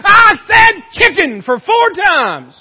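loud, high, slow-stretched comic yell; wife bellowing at her deaf husband, hammed up; exasperated shout. Exasperated wife turns around, punchline.

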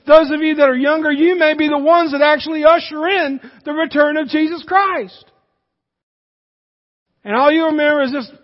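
loud, fired-up pulpit preaching; full-force preaching. Acted. Preacher rousing the young people in the congregation, full-force preaching. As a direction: preach it out to the whole room.

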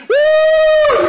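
very high, long, loud woo; thrilled whoop. Acted. Rider at the top of the hill, thrilled whoop. Coaster plunging after the top.

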